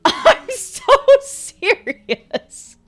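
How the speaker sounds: high, drawn-out words through laughter; laughing. Real, not acted. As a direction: insist it while cracking up. Insisting while cracking up.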